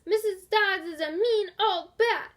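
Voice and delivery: loud, high taunting yell; children yelling. A narrator's reading. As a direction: jeer it across the fence. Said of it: "When the children yelled".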